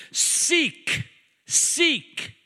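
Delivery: high punched seek, seek; emphatic repeat. Acted. Preacher reading scripture, hammering the word seek.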